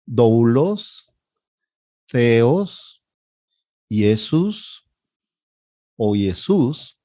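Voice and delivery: each Greek word drawn out with a lifting end; slow model vocabulary reading. Shown as a demonstration. Teacher models Greek vocabulary for class repetition. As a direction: model the words for students to echo. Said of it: "Traten de repetirlo después de mí".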